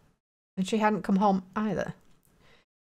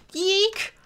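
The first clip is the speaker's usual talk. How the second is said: startled squeak; 10 semitones higher than usual. Acted.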